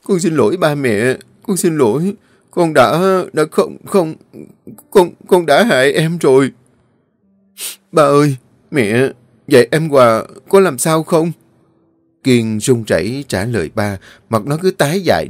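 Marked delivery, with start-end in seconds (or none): 0.0-11.3 choked sobbing. Acted. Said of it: "nó nghẹn ngào".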